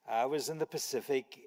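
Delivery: usual speaking voice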